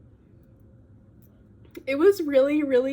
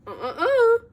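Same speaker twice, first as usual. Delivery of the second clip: very high, laughing "uh-uh-uh" tease; sing-song no-no-no with a laugh; playful high-pitched denial; teasing refusal; 12 semitones higher, 5 dB louder than usual. Retold or imitated. Girl teasingly refuses a kiss.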